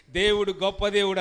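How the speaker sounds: loud, high joyful cry of praise, with a laugh; retold exclamation. Man at the prayer meeting proclaims to everyone, loud and laughing. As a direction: burst out as the man grabbing the mic. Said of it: "అన్నాడు".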